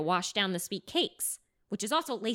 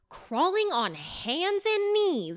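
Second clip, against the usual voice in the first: slow eerie; slower than usual. A narrator's reading. Horror narration.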